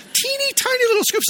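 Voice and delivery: way up high, cooing over something tiny; cutesy mock voice. Pastor mocking the tiny ice cream scoops, high cutesy coo. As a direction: squeak it like baby talk.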